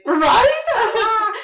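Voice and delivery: high, loud shrieked agreement; excited agreement. Retold or imitated. Fan agreeing with her idol, shrieking. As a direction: shriek it back, utterly thrilled.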